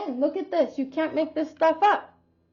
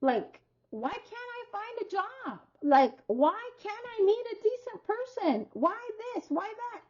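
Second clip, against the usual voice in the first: frustrated whine; 7 semitones higher, 7 dB quieter than usual. Retold or imitated. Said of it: "all of a sudden you're like".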